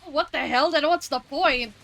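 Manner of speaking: loud, high what the hell; shocked and indignant; outraged disbelief. Real, not acted. Shocked by the Pokédex entry.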